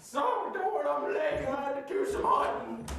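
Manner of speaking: very high-pitched put-on country voice; excitable country hunter. Telling the hosts about hunting, in a high-pitched hunter voice. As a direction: squeal it like a cartoon country hunter.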